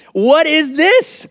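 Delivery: high, loud, drawn-out mock disbelief with a laugh; the wife's voice shoots up in amused outrage. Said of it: "she says".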